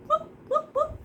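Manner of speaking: high squeaky whooping; high whoops. Pretend. Teacher whooping playfully at each paint streak.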